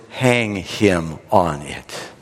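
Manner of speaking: king's verdict. Acted. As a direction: hand down the sentence word by word, sinking in pitch. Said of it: "And the king says".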